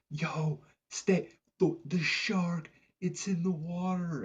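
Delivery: high, strained imitation of calling out; mock warning call. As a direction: imitate warning people from a distance. Woman calls warning to surfers.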